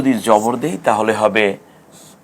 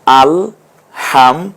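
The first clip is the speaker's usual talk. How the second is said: slow, split হাম দু apart; broken into syllables; much slower than usual. Shown as a demonstration. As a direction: say হাম and দু separately, slowly. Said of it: "একটু ভেঙে ভেঙে বলুন".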